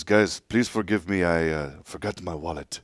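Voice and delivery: put-on quiet, apologetic French accent; imitating a Frenchman's sheepish apology in a French accent. Imitating Tony's dad excusing himself at dinner, in a French accent. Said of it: "he has a very distinct accent".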